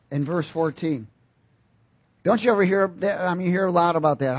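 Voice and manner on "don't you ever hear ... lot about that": ordinary talk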